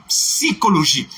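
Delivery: Psychologie with a clear P at the start; ps-ychologie, sound the P. Shown as a demonstration. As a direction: say Psychologie with P audible. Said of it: "Il faut prendre toujours le P".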